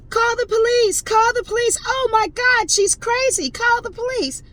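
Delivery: mock screaming Karen. Karen screaming at the driver to call the police, mock shrieking. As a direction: shriek it like a hysterical accuser. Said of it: "she starts screaming".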